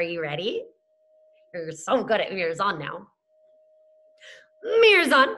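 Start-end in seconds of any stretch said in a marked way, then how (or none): 4.8-5.3 louder, higher call to the class; loud class cue. Shown as a demonstration. Teacher calling the class to use their mirrors, loud and bright. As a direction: call it out to get the kids watching.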